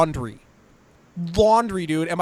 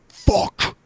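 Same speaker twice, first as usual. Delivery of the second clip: hoarse curse. Retold or imitated. Friend on phone, hurt back.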